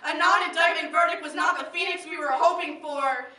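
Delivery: loud, forceful denunciation; angry declaration. Acted. Poet denounces the verdict to the crowd, angry declaration. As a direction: pronounce it with outrage.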